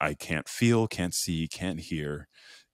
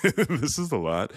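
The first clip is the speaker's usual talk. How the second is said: said through a laugh, a bit higher; 8 semitones higher, 4 dB louder than usual. Real, not acted. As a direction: laugh it off, overwhelmed. Repeats it, laughing.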